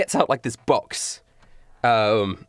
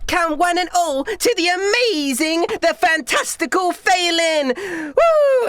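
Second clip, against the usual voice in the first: high, loud drawn-out carnival hype; big squealy showgirl announcement; stage magician showman; 3 dB louder, slower than usual. Acted. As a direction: ringmaster whipping up the crowd. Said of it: "she goes".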